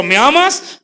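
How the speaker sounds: Jesus pressing Peter; pressing question. Acted.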